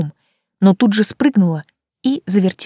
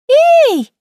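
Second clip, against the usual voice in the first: high startled squeak; a sharp high yelp; 6 dB louder than usual. A narrator's reading. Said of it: "пискнула ему вдогонку Хан". (тонкий испуганный писк)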